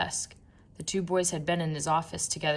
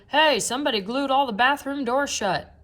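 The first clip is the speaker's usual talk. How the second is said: higher, louder call; shout; 7 semitones higher, 6 dB louder than usual. A narrator's reading. People at the game calling out, higher loud shout. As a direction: yell the news to the crowd. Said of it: "people shouting".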